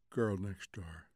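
low, soft, sleepy question; drowsy. A narrator's reading. Wife puzzled by husband's question, drowsy. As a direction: mumble it half asleep.

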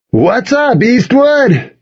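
very high-pitched hype shout, volume held back; high, hyped greeting to a crowd; booming. A narrator's reading. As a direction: boom it out like a pep-rally emcee. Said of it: "he boomed".

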